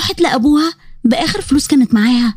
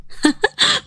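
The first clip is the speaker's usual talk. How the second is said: giggle; 7 semitones higher, 4 dB quieter than usual. Acted. Friend giggles at teasing.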